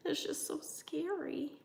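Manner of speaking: whispered aside; half-whispered worry; hushed nervous confession. Real, not acted. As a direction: whisper it like an anxious admission. Admits nerves about solo sale.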